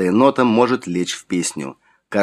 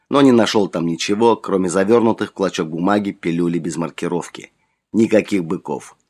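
ordinary delivery, nothing marked

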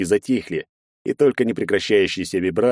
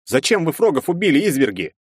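higher indignant accusation; 7 semitones higher than usual. Acted. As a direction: accuse them hotly. Hero accuses demons.

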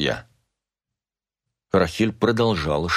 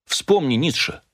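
higher-pitched, emphatic appeal; urging; 7 semitones higher than usual. A narrator's reading. Husband invokes Nietzsche to persuade Rachel, urging. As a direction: urge it with the voice lifted.

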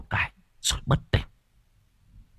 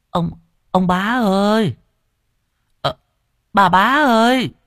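slow, drawn-out call, much higher and louder; 12 semitones higher, 3 dB louder than usual. Acted. Old woman calling the household from the gate, drawn-out loud call. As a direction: call across the yard to the house.

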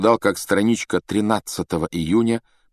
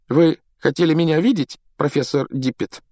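higher-pitched, mildly nervous question; a touch of nerves, politely asking; 7 semitones higher than usual. A narrator's reading. Riddle asks the professor why he was summoned, nervously. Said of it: "Реддл явно нервничал".

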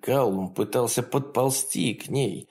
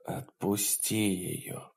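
weak, slow, quiet plea; muttered; 9 dB quieter, slower than usual. A narrator's reading. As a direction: barely voice it, drained. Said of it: "пробормотал Каллум".

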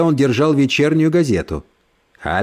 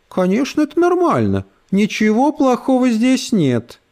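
sugary condescending lady; 10 semitones higher than usual. Acted. Брит Мари снисходит.

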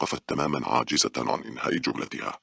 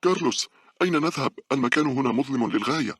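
high-pitched narrated shout; raised, high character voice calling out; 12 semitones higher, 4 dB louder than usual. A narrator's reading. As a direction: call after him in a much higher voice. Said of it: "صاحت به".

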